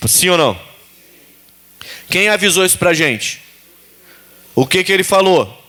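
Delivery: ordinary delivery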